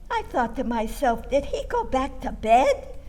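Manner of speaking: usual speaking voice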